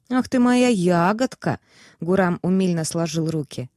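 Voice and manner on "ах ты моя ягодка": delighted. A narrator's reading. Host delighted by the guest.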